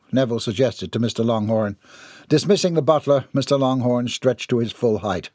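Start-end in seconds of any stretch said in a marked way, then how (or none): none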